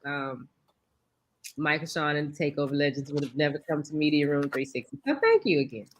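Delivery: normal talking voice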